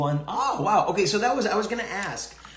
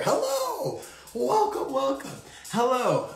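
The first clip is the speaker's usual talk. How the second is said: mock greeting, much higher voice; playful greeting; 12 semitones higher, slower than usual. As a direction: sing out a high, teasing hello to each newcomer. Greeting viewers joining the stream.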